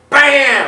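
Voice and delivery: sudden high, loud bam. Retold or imitated. Telling friends the arm snap, loud bam. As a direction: punch out the impact noise. Arm re-broken, sound effect.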